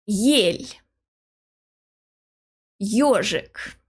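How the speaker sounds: "Ель" said yel, "Ёжик" said yozhik; the initial vowels glide in with a clear y-sound; alphabet vowel sound. Shown as a demonstration. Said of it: "pronounced as they are in the alphabet".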